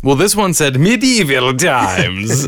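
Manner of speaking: medieval times said grandly; performed medieval times. Shown as a demonstration. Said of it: "I like that one more".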